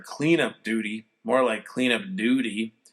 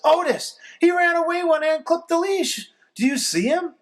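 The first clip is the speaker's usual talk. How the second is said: high, anxious mother's voice; urgent and out of sorts; worried mom; 4 dB louder than usual. A narrator's reading. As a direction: mom asking her son to find the dog. Mom upset about puppy.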